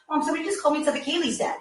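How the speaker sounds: high, rushed report of bad news; retold panic. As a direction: blurt it out fast as the frightened daughter. Mother retells daughter breaking the news.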